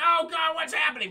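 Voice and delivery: high, loud mock cry of alarm; mock panic. Tipped into the river, panicking in a mock yell. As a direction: yelp it like a panicked canoeist. Retelling canoe flip panic.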